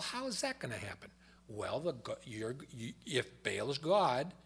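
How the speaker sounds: ordinary talk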